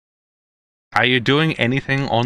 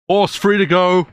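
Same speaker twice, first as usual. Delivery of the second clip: higher-pitched gate guard announcement; guard calling clearance; 9 semitones higher, 4 dB louder than usual. Acted. Game master as guard releases the horse, official call. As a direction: wave them through in a raised official voice.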